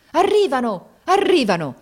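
high, loud shout of joy; very loud cry. A narrator's reading. Sergeant and captain seeing rescue arrive, joyful loud cry. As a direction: shout the joyful news to everyone. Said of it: "gettò un grido altissimo".

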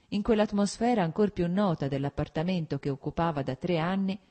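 usual speaking voice

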